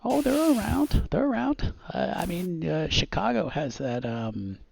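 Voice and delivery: high-pitched insistence settling toward normal; a hint of hometown big shot; lightly voiced boisterous visitor. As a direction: insist it like a know-it-all from the city. Plays visitor insisting superheroes are real.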